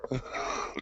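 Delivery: quick soft chuckle at the Canadian; soft laugh. Real, not acted. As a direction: laugh under the breath. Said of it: "I'm just laughing".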